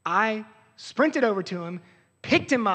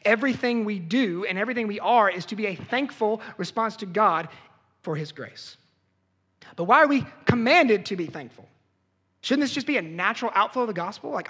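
normal talking voice

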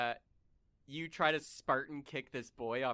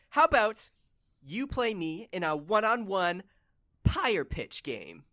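eager challenge; 8 semitones higher, 8 dB louder than usual. Acted. Boy proposes the wager.

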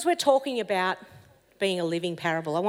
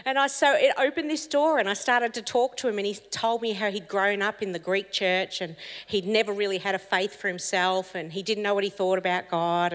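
usual speaking voice

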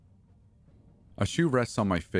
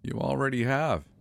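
mild growl; 7 semitones higher than usual. A narrator's reading. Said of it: "she growls".

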